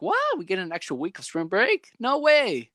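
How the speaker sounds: high-pitched disbelieving "what"; retold amazement. Retelling students hearing of extra spring break, delighted surprise. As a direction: replay a schoolkid's happy disbelief. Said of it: "Then, like, we were like".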